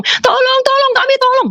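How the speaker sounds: high-pitched crowd crying out for rescue; screamed cries for help. Retold or imitated. Retelling how all the people begged for help, screaming. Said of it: "teriak-teriak minta tolong".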